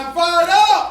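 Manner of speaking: high, very loud shout; fired-up rally call. Shown as a demonstration. Leader pushing audience toward Baptist fervour, high shout. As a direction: belt it like a revival leader. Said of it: "A little Baptist".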